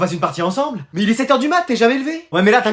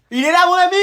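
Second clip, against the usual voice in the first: higher mock friendly call; higher, a teasing call for his friend; sing-song call; 8 semitones higher, faster than usual. Friend calls in high voice.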